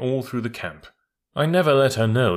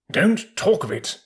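agitated troop horse; 7 semitones higher, 3 dB louder than usual. Acted. Troop horse recoils from blood talk.

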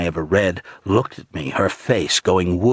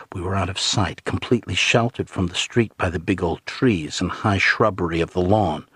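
ordinary delivery

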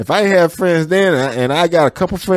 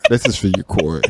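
deep seductive voice; 10 semitones lower, 5 dB quieter than usual. Pretend. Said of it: "Why do you keep saying her name like that".

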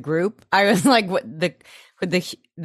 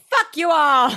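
defiant shout; 8 semitones higher than usual. Real, not acted. To the whole room, about dressing up: a playful defiant shout. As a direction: fling it out at everyone, laughing.

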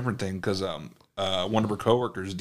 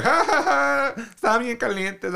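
higher, teasing ha ha ha; mocking laugh; 13 semitones higher, 3 dB louder than usual. Waiter teases a sweating customer with a mocking laugh. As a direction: laugh at him, then tease in Spanish. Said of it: "made fun of you".

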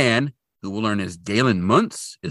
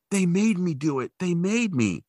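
near tears; 7 semitones higher than usual. Retold or imitated. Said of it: "starting to get near tears".

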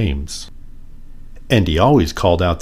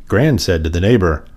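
ordinary delivery, nothing marked

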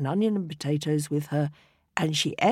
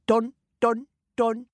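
knocking; 7 semitones higher, 4 dB louder than usual. A narrator's reading. Knocking sound.

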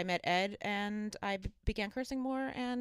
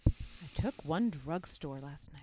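society girl impression; 4 dB quieter than usual. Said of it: "in your best society girl impression".

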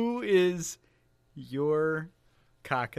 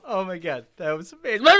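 ordinary talk

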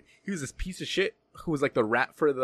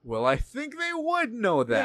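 slow, high-pitched disbelief; exasperated, stretched-out emphasis; incredulous retort; 8 semitones higher, slower than usual. Retold or imitated. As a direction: drag it out as if it's painfully obvious. Replaying his incredulous reply.

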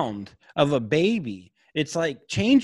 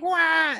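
mock baby cry; 14 semitones higher than usual. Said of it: "when they're like".

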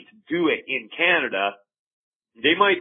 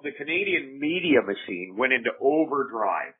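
ordinary talk, nothing marked

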